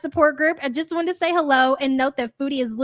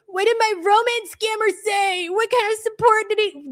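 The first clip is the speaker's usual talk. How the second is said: mimicking her; 8 semitones higher than usual. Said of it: "the way that she squinted at the screen".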